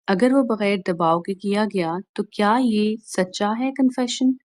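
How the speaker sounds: normal talking voice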